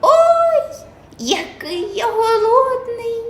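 very high, slow singsong mouse. A narrator's reading. Mouse Krut cries about hunger, high singsong. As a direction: whine like a hungry little mouse. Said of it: "каже Круть".

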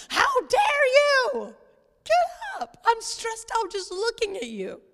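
high, drawn-out mock indignation at a lounging husband; mock outraged wife. Wife scolding her relaxing husband, high mock outrage. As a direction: play the exasperated wife, pitch way up.

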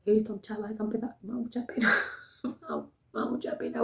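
fast, tearful confession barely held together; choked-up sobbing. Retold or imitated. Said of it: "tenía ganas de llorar".

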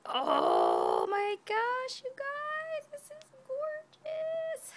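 high, gushing excitement with stretched loud exclamations; thrilled squealing. Real, not acted. Showing you guys the gorgeous gift, thrilled squeal. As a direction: squeal it out, drawing out the amazement.

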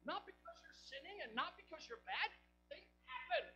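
choked, tearful, nearly crying; voice breaking. Real, not acted. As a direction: let the voice crack with emotion. Preacher's voice breaks mid sermon.

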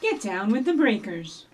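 normal talking voice

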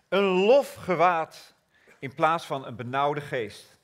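drawn-out preaching cadence. Acted. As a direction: let each gift ring out. Reads Isaiah proclaiming.

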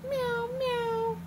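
high, soft playful meow; cat meow. Pretend. Parent showing a toddler how, playful cat meow. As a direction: mew like a little cat. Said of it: "meow like a cat".

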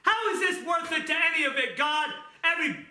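a high, exasperated mother at wit's end; complaining mom. Retold or imitated. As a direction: whine it like a worn-out mom. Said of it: "or moms that stay at home".

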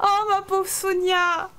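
high, drawn-out wail of pity; long theatrical lament; mock lament. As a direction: mourn her with hammed-up sorrow. Mourning the dead Sim.

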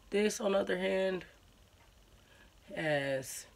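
talking through a face mask; wearing a sheet face mask. Real, not acted. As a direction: lips framed by mask opening. Seen 0: Wet sheet mask on face throughout; mouth hole open, fingers adjusting.

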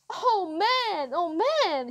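high-pitched disbelief; shocked exclaiming. A narrator's reading. Boy gaping at a face, shocked exclamation. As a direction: exclaim in amazement.

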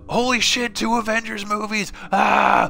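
mock fan rejoicing, yelled up high; mock fan cheering. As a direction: parody a crowd losing it over movies. Mocking celebrating fans.

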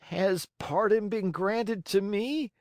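high, slow, timid puppet; high-pitched cautious question; faint, timid asking. A narrator's reading. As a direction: ask in a thin high voice, barely daring. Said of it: "with a voice that was hardly a breath".

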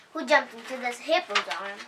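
usual speaking voice

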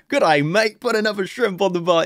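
loud, high hammed-up Aussie accent; jokey Australian accent; mock Australian movie quote. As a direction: quote the film in a silly Australian voice. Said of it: "Dumb and Dumber".

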